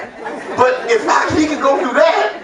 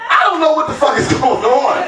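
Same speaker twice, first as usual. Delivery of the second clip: ordinary talk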